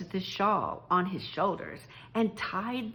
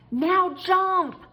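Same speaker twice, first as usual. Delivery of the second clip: shouted, high and loud; calling out; 10 semitones higher, 8 dB louder than usual. Acted. Driver shouts to Toad over the screeching train, loud call. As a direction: bark the order over the train noise. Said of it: "Toad heard him call".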